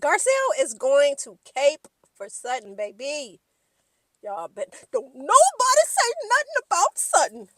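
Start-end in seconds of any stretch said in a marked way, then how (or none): 4.2-7.5 high, loud hammed-up warning; mock warning. Warning viewers off talking about Sutton, mock alarmed shout. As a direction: cry out a playful warning, pitched way up.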